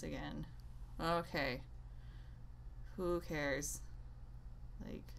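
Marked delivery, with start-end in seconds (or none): none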